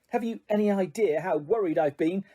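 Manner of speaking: usual speaking voice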